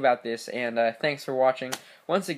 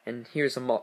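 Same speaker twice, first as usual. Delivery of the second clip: ordinary delivery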